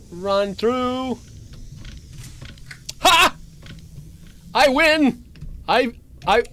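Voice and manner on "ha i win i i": high, loud victory whoop; triumphant shout. Real, not acted. Escaping the monster through the door, triumphant shout. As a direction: whoop like you just beat the game.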